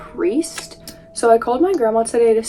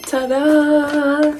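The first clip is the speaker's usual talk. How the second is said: ta-da reveal; 5 semitones higher, 4 dB louder than usual. Real, not acted. Reveal after fetching food.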